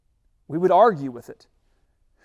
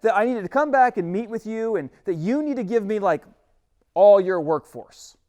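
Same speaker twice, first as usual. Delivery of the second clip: arguing Moses; 5 semitones higher, 5 dB louder than usual. Acted. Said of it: "And Moses argues again".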